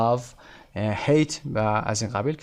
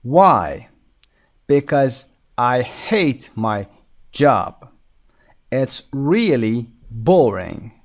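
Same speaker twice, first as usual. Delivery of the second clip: very slow, punched "why", "hate", "job", "really", "boring"; stressed key words; 4 dB louder, much slower than usual. Shown as a demonstration. Teacher modelling dialogue lines for students, heavily stressed key words. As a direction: hit "hate", "job" and "boring" hard, pausing between words.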